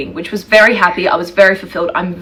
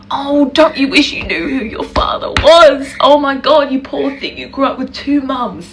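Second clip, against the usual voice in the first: higher, gushing pitying commenter voice; mock pity; 5 semitones higher than usual. Woman mimics commenters on her two mums, mock-pitying. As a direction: mimic an over-sympathetic stranger, pitched up.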